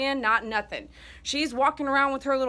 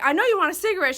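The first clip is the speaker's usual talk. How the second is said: teasing cigarette offer; 6 semitones higher, 5 dB louder, much faster than usual. Retold or imitated. Teasing dying friend about smoking.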